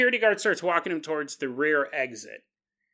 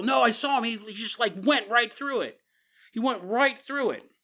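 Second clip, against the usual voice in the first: higher-pitched, insistent mock protest; drunk's protest; 5 semitones higher than usual. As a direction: protest like a drunk kid who swears he saw it. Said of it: "It's a protest of a drunk".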